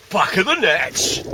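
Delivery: slow, loud triumphant catchphrase; excited cheer. Real, not acted. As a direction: crow it out like scoring a goal. Celebrates finding a tenner.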